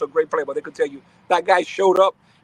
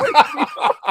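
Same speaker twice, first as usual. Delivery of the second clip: laughing. Real, not acted. Laughing while retelling.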